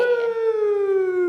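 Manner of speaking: high excited woo; excited cheer. Real, not acted. Cheering for the holiday.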